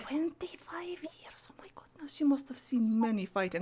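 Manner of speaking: very soft, astonished whisper; hushed, marvelling whisper; awed whisper. Acted. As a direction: whisper in hushed amazement at her service. Character awed by Greta's service.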